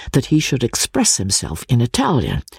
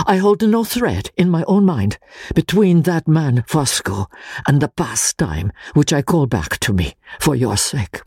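higher, fervent begging friend; begging plea; 5 semitones higher than usual. Acted. Pesca begs Walter to keep him ignorant, fervent pleading. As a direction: beg on your knees to stay ignorant.

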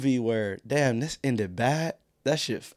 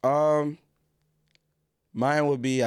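normal talking voice

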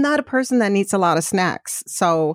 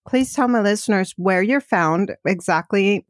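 ordinary talk, nothing marked

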